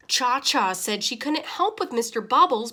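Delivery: usual speaking voice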